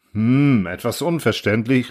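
ordinary delivery

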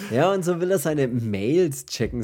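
Mails checken slow and loud in audible air quotes. Shown as a demonstration. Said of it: "Gänsefüßchen in der Luft".

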